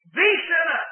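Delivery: "the" hit high and loud before sinner; stress on THE. Shown as a demonstration. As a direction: lean hard on "the", as if the only sinner. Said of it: "The emphatic word is there".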